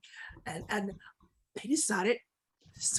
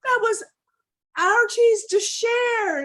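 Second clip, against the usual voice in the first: loud, high anguished cry; upset dog; 11 semitones higher, 9 dB louder than usual. Acted. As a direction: wail the accusation at full voice. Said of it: "Dog was so upset".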